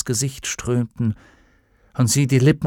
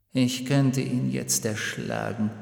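murmured darkly; 8 dB quieter than usual. A narrator's reading. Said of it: "raunte der Schatten".

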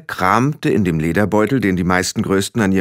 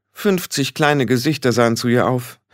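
ordinary delivery, nothing marked

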